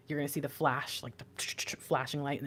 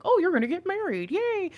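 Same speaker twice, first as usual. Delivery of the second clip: high, loud mock gushing; mock excited squeal; 8 dB louder than usual. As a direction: squeal it like a thrilled friend. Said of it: "No, I think this is saying".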